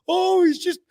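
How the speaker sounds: high, loud, drawn-out mock whine; exaggerated parody of the other side, stretched out high; mocking imitation. Mimicking the excuse a challenger would make, mocking.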